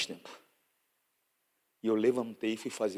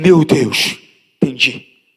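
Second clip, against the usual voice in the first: sudden realization; 15 dB louder, much slower than usual. Retold or imitated.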